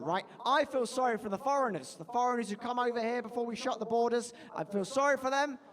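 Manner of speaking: ordinary talk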